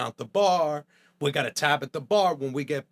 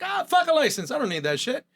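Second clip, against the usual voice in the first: high, louder scoff; cocky dismissal; 10 semitones higher, 4 dB louder than usual. Retold or imitated. Young cook scoffing at needing a license, loud and high. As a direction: scoff it away. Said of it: "I was like".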